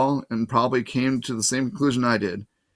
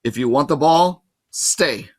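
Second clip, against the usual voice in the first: firm command to dog; 3 semitones higher, 5 dB louder, slower than usual. A narrator's reading. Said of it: "Annabeth ordered the monster".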